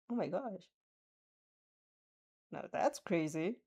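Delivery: plain reading voice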